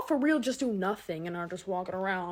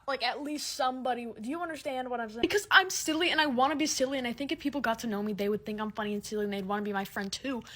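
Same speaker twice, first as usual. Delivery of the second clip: ordinary talk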